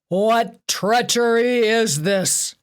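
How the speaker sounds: 低く太くはない、高く大きくゆっくり怒鳴る; 王の怒りをぶつける; bellow. A narrator's reading. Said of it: "Zeus bellowed".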